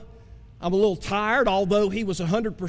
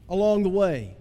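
ordinary talk, nothing marked

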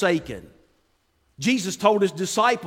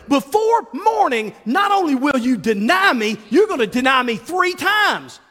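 emphatic warning; 7 semitones higher, 6 dB louder than usual. Retold or imitated. Jesus warning Peter to his face, loud and emphatic. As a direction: hammer out the prophecy.

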